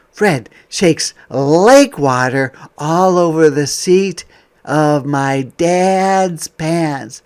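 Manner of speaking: bright, lively picture-book voice; reading to children. Acted. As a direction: read it out to a class of kids.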